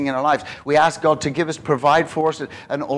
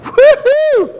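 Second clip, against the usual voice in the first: very high falsetto whoop; gleeful whoop; 10 dB louder than usual. Pretend. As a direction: whoop like you won. Mock reaction again.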